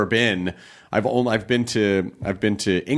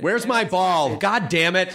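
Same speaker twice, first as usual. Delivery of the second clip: higher-pitched mock irritation; griping golfer impression, pitched up; mock yelling golfer; 8 semitones higher, slower than usual. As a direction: imitate a peeved rich golfer, without really shouting. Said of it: "getting yelled at by rich people".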